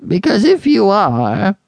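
put-on high, slow, complaining old voice; querulous old man. A narrator's reading. Old-man baby to his father, querulous and high. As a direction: drag it out querulously in an ancient voice. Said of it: "went on the old man querulously".

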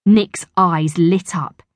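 plain reading voice

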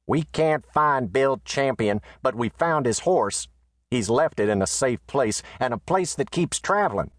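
high, reporting voice; letter writer's voice. Acted. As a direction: read it as Bates would say it. Reads Bates's letter aloud.

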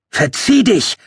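high-pitched yell at a monster; desperate scream. A narrator's reading. Narrator's last try at ordering the Hollow off, shouted high. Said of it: "schrie ich den Hollow in einem letzten Versuch an".

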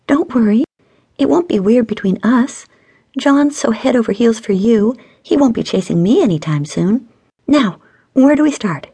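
high, breezy woman's character voice; eager, reassuring friend. A narrator's reading. Stacy reassuring her friend before they start, breezy.

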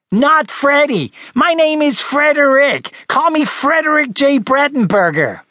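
high, drawn-out indignant shout; angry shout. Acted. Small boy correcting Charlie about his name, shouting indignantly. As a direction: shout it slowly, every name hammered out. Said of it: "he shouted".

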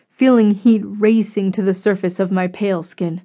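usual speaking voice

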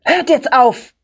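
exasperated father's outburst; shouted order. A narrator's reading. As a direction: put a stop to the squabbling. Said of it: "schrie er uns an".

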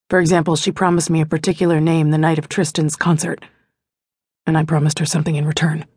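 a hint of a brooding lover opening up; Sean, confiding. A narrator's reading. Sean's confession, lower voice.

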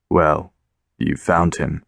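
low, deep, gravelly man's voice. A narrator's reading. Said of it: "in a deep, gravelly voice".

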